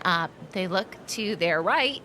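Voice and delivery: ordinary talk, nothing marked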